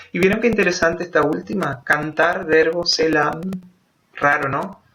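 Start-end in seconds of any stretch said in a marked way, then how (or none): none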